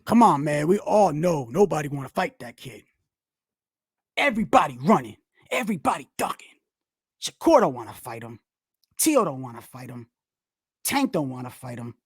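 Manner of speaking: low mock voice for the rumor crowd; mocking hype talk. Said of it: "don't be like".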